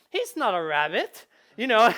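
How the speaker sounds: loud protest of a disillusioned player; mock outrage. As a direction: exclaim it like a betrayed gamer. Player's outrage at discovering the system.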